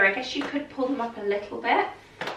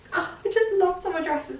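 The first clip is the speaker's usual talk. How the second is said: higher, delighted gush; thrilled about summer dresses, higher; 8 semitones higher than usual. Real, not acted. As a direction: swoon over summer dresses. Gushing over the dress.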